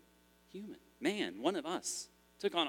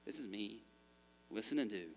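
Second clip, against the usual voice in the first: much softer, quietly certain; calm authority; 3 semitones lower, 7 dB quieter than usual. Acted. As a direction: say it like it needs no defense. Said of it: "He just talks like".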